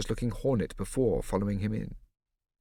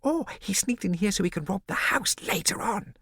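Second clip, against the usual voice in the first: put-on little boy voice; 11 semitones higher than usual. A narrator's reading. Bo whispering to Prosper.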